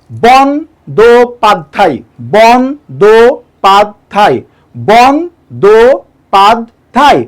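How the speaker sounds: বন্দ্যোপাধ্যায় slowly as "bon-do-pad-dhai"; four separate, stretched beats on বন্দ্যোপাধ্যায়; syllable by syllable. Shown as a demonstration. Teacher counts four syllables, বন্দ্যোপাধ্যায় slowly split up. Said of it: "এটাকে উচ্চারণ করেন".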